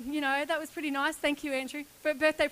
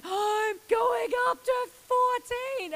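panicked realisation; 9 semitones higher, 5 dB louder, slower than usual. Retold or imitated. Realising the jump height mid-flight.

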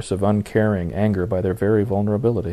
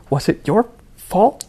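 much higher, slow strained plea; near breaking down; 10 semitones higher, slower than usual. Acted. Raven nearly breaks down confronting Jason. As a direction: hold back tears as you ask. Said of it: "she almost broke down".

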